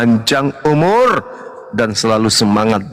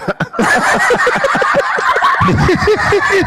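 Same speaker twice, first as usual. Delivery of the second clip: shrieking laugh, much higher; high burst of laughter. Real, not acted. Loud laugh after joke. (tawa melengking keras)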